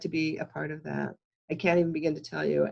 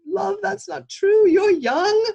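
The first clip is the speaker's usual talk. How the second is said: much higher, louder cabbie voice, comforting; kindly taxi driver; 13 semitones higher, 7 dB louder than usual. Retold or imitated. Taxi driver consoling a crying passenger, high pitched and loud. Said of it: "and he's like".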